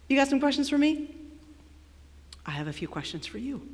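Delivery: soft, quick, understated retort as God; cool challenge. Acted. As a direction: quietly turn the tables. God coolly challenges Job.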